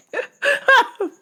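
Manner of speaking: high burst of real laughter; high-pitched cackle. Real, not acted. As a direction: laugh at your own joke. Laughs at own bit.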